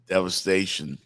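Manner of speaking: normal talking voice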